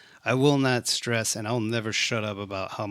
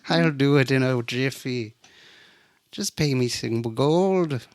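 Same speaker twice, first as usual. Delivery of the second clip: mock Irish accent; 2 semitones higher than usual. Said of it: "We're sounding Irish".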